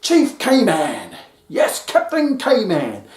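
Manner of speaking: high, slow, hammed-up triumphant chant; mock victory cheer. Hunter cheering himself after defeating lion, mock triumphant. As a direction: crown yourself champion over the lion, half-joking. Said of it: "I go".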